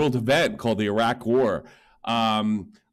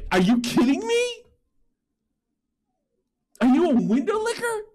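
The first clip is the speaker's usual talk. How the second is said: pitched way up, incredulous and indignant; shrill disbelief, voice climbing higher; high-pitched rant. Real, not acted. [name] rants at the streamer, high and incredulous.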